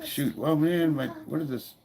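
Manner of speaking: normal talking voice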